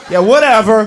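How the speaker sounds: normal talking voice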